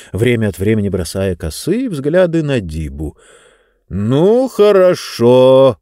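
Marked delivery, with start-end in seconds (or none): 3.9-5.8 out loud, slow and firm; full voice. Acted. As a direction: suddenly speak up, drawn out. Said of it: "вдруг в полный голос произнёс".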